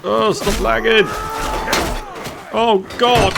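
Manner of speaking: high, loud exasperated shouts; frustrated yelling. Real, not acted. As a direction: yell at the game mid-fight. Frantic combat, yelling at game.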